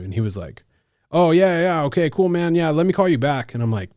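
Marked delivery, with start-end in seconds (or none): 1.1-3.5 high, loud, over-cheerful phone voice; breezy brush-off. Retold or imitated. James putting the caller off, breezily imitated. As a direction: imitate someone hurrying off a call.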